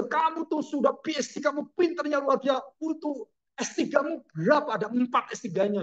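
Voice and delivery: high, scolding, almost shouting; angry father. Acted. Preacher voicing an angry father who scolds his son Francis. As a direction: scold a brilliant son throwing it away. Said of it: "Papanya marah dengan Francis".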